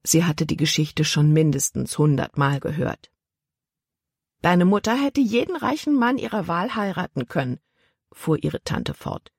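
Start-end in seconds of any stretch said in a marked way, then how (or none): 4.4-7.6 a lightly higher, prim aunt voice; a hint of a stern aunt; aunt's voice. A narrator's reading.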